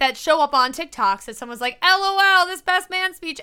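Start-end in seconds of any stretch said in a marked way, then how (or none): 1.8-3.4 higher-pitched mimicry of an online poster; lightly mock a viral speech-giver; pitched-up social media imitation; mock TikTok poster.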